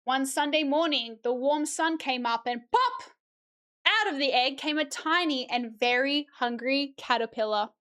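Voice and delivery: storybook read-aloud, rising as hunger builds; reading to children. Acted. Streamer reads The Very Hungry Caterpillar to chat, storybook voice. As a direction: read it like story time, lifting each still hungry.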